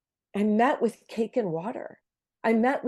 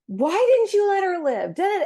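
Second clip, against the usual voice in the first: high, louder retold cry of grief; yelling at God; 11 semitones higher, 4 dB louder than usual. Sobbing in her car, retelling her cry at God, high and louder. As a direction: re-enact the anguished outcry, pitched high. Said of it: "yelling at God".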